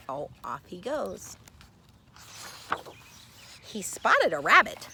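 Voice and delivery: ordinary delivery, nothing marked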